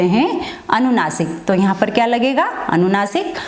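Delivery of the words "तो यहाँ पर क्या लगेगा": ordinary delivery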